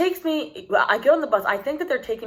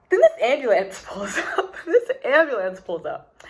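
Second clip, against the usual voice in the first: laughing; 4 semitones higher than usual. Real, not acted. Laughing at the absurd ambulance.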